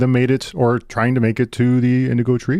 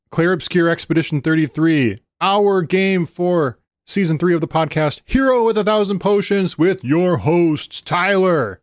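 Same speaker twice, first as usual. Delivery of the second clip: big, high podcast intro announcer; 7 semitones higher than usual. Acted.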